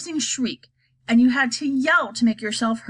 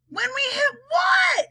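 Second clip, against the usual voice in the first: very high, alarmed shout; high-pitched shriek of a question; cried out. A narrator's reading. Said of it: "they cried".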